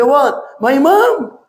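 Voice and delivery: high-pitched startled disbelief; shocked question. Retold or imitated. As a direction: react as if struck by the news. Imam shocked on phone. (terkejut, nada tinggi tak percaya)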